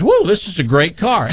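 high-pitched gushing over a car; mock amazed review. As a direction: play the easily impressed reviewer. Mimics his own naive car review.

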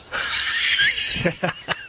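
airy chuckle under the breath; soft breathy laugh. Real, not acted. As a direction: laugh quietly at the absurdity. Laughs at own complaint.

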